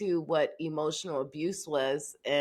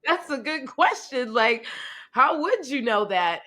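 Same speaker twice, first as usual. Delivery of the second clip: higher, amused retelling; 7 semitones higher, 3 dB louder than usual. Retold or imitated. Mother quoting her reaction to her son, amused. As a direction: replay the moment with a grin.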